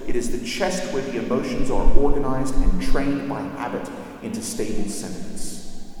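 usual speaking voice

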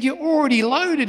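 high-pitched indignant accusation; acted outraged dad; irate father. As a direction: accuse your child in rising outrage. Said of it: "starts to get really irate and really upset".

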